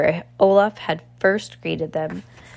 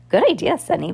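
high, louder exclamation of approval; excited cry; 6 semitones higher, 5 dB louder than usual. A narrator's reading. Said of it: "Violet cried".

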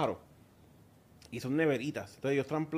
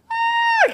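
mock panicked scream, very high and loud, drawn out; imitated scream; 10 dB louder than usual. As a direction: shriek like watching the drone fall. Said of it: "tú estabas gritando viéndolo en el teléfono". (grito imitado, muy agudo y alargado)

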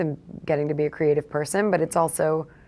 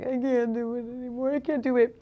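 retold crying despair; 7 semitones higher than usual. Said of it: "crying on the floor".

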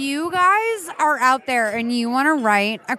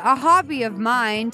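ordinary delivery

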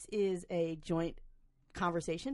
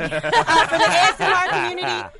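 excited; 9 semitones higher, 12 dB louder than usual. Acted. Excited ASMR pitch.

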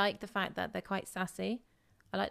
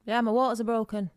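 put-on louder English-accented caller; 3 semitones higher, 6 dB louder than usual. Caller reports broken waters to front desk, loud English accent. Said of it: "That was a really good accent".

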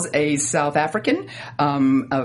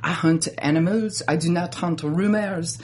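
put-on measured, amateur French accent; mock French, slowing toward the end; slower than usual. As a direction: quote her line in a cartoonish French accent. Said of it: "can I do it in my French accent".

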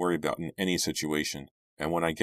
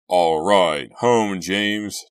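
loud, jubilant call; happy cry; 2 semitones higher, 9 dB louder, much slower than usual. A narrator's reading. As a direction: call it out happily, good and loud. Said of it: "Doug cried happily".

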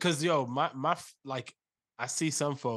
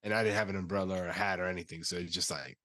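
congested, sick voice; under the weather, stuffed up; stuffy nose; 5 semitones lower, 5 dB louder than usual. Real, not acted. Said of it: "you sound a little under the weather".

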